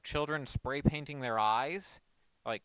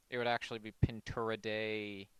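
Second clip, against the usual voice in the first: ordinary talk, nothing marked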